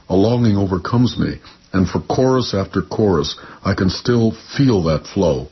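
measured, lyrical poem reading; poetry reading. Acted. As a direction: read it like a poet on stage. Poet reads his poem.

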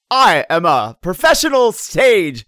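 put-on slow, loud, grand stage-actor British accent; pompous British actor. Mocking bad British actors to a co-host, in a grand British accent. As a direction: proclaim it like a stuffy British thespian. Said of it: "it's like".

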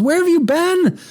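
high-pitched imitation of a frantic yell. Lady runs up to the runner, frantically yelling. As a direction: cry it out high, imitating her demand. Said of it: "yelling at us".

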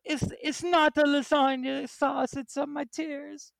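high, slow fake weepy voice; fake crying. Mock crying bit.

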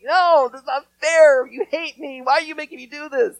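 a lightly voiced kid complaining about washing the wall; whiny kid. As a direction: protest like a sulky little kid. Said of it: "start crying".

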